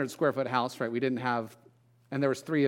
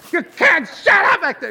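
much higher, loud mock yell of a fed-up mom; 10 dB louder than usual. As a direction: snap at the kids behind the wall. Mom yelling at kids.

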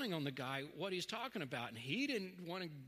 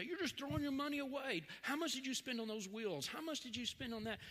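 imitating a scolding dad, higher; mock exasperated father; hint of a nagging dad; 6 semitones higher than usual. Dad scolding son over car money.

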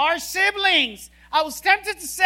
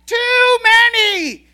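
loud, high, panicky husband shouting orders; hammed-up yell of a fussing husband; mock frantic yelling; 5 semitones higher, 6 dB louder than usual. Husband yelling cooking orders at his wife, mock frantic shout. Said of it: "he begins to scream at the top of his voice".

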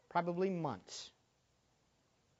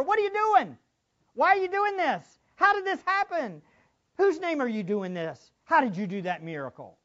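much higher, louder rapid-fire questions; mocking interrogation, pitched way up; badgering interrogators; 10 semitones higher, 8 dB louder than usual. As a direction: pepper them like a hostile questioner.

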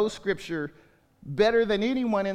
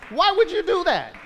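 much higher and louder disbelief; exasperated; 11 semitones higher, 6 dB louder than usual. Real, not acted. Pastor exasperated at people asking strangers online, loud. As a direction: cry out why in exasperation.

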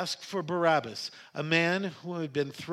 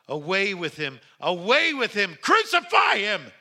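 raised call climbing to a high, full shout; crying out, building; 5 semitones higher, 8 dB louder than usual. A narrator's reading. Crowd rejects Jesus as king, crying out louder. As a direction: build each cry to a huge shout. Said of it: "they cried out".

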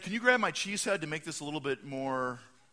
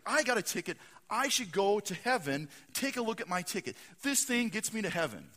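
higher, emphatic self-justifying claim; insistent; 6 semitones higher, 3 dB louder than usual. Acted. Packers fan showing his ticket to angel, insistent. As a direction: argue your case firmly.

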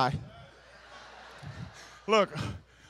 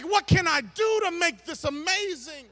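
high-pitched mock eagerness; eager husband; 7 semitones higher than usual. Husband heading home to his wife, eager. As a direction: play a husband keen to please.

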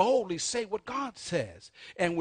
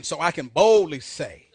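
call and response; 2 semitones lower, 11 dB louder than usual. Shown as a demonstration. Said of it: "Say it again".

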